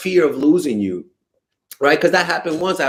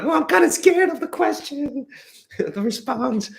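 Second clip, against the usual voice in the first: squeaky falsetto mocking the listener; high put-on girly voice, half laughing; imitating her shy answer in falsetto; 11 semitones higher than usual. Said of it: "I'm like".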